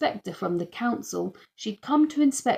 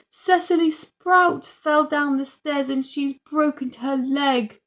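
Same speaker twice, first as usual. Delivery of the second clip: put-on higher, urgent child's cry; 7 semitones higher, 4 dB louder than usual. A narrator's reading. Little sister bursts in, crying out about her doll. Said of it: "she cried".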